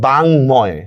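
বাংময় drawn out as "bang-moy"; sounded m. Shown as a demonstration. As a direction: say বাংময় slowly with a clear m. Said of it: "মফলার উচ্চারণই কিন্তু হচ্ছে".

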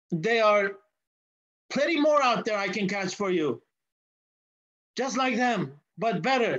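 lightly voiced boy pushing his sale; a hint of a keen young seller; boy's boast.

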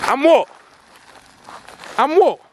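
daring the driver to try something; challenging shout. Acted. Vlogger challenging the masked driver, loud shout.

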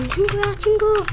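high loud panicked calls. Real, not acted. Streamer begs other players to save him, high panicked yelling. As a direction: yell for help in a hurry.

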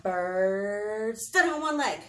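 slow, drawn-out storytelling; deliberate cue for children to copy; dramatic narration. A narrator's reading. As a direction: stretch the bird's name to build anticipation.

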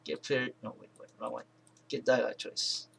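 ordinary talk

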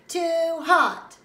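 loud, drawn-out whine; loud complaint. Acted. Child objects to supper peas, loudly complaining. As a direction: stretch and punch both words.

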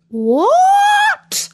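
very high, startled squawk; turkey squawk. A narrator's reading. Befuddled turkey coming back to himself, squawking. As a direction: screech it up like a startled bird. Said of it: "he squawked".

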